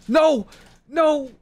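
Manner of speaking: high loud no's; alarmed shout. Real, not acted. Gamer shouting in alarm at teammate's mistake. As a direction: cry out in dismay.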